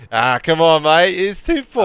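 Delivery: hammed-up Aussie accent, high and loud; poor Aussie accent. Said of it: "Poor Aussie accent".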